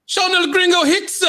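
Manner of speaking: high shouted ring introduction; announcer shout. Acted. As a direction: shout your own name like a ring intro. Co-host introduced announcer style.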